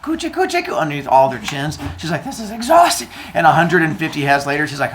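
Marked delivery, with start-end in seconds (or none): 0.0-0.8 put-on high-pitched baby-tickling coo; cooing at babies. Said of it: "gives them little coochie coos". 2.2-3.1 high-pitched complaint; hammed-up worn-out mother; mock exhaustion. Mother Earth tired of tickling babies.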